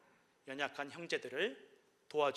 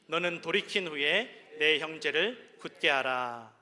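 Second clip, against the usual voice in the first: slow, loud solemn Bible reading; slow, loud and weighty from the pulpit; scripture reading; 2 semitones higher, 12 dB louder, slower than usual. Acted. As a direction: proclaim each verse slowly to the congregation. Scripture read aloud in service.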